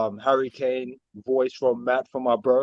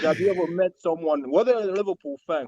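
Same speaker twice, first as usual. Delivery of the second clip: ordinary talk, nothing marked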